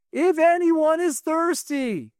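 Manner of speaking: high, slow, drawn-out proclamation; proclaiming loudly. Retold or imitated. Yeshua standing up at the feast, proclaiming loudly. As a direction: call it out slowly to a crowd. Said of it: "he says loudly".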